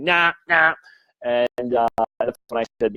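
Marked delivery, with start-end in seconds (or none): 0.0-0.7 high, dismissive imitation of a client saying "nah"; mock the client's flat-out denial in a raised pitch; mimicked refusal. Skeptical client denying the watch, mimicked refusal. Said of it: "and she's saying".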